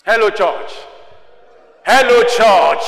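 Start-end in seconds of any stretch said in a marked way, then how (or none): 1.8-2.8 loud, slow call to the congregation; drawn-out, forceful greeting. Real, not acted. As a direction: project it across the church. Repeats the call louder.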